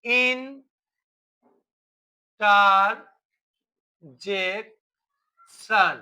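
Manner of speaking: Interjection very slowly, "in... tar... jek... shon"; interjection as four isolated, stretched beats; split into syllables. Shown as a demonstration. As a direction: leave a long pause after each of the four syllables of interjection. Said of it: "একবার দেখো ইন".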